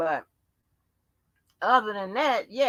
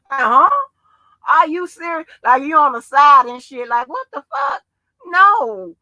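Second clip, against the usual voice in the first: higher and louder, disbelieving; incredulous outburst; 6 semitones higher, 8 dB louder than usual. Real, not acted. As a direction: protest in loud disbelief. Incredulous at being blamed.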